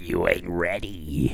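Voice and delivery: slow, low whispered tease. Pretend. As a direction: breathe it out as a whisper. Soundboard drop line.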